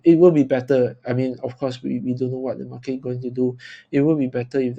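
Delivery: ordinary delivery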